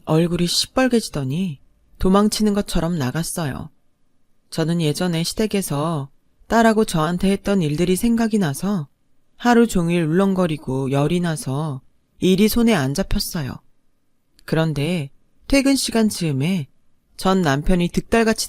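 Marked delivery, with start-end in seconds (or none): none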